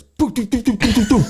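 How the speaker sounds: high, rapid staccato nail-gun noise; imitated nail-gun fire. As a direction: mimic a burst of nail-gun shots. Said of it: "everybody just starts".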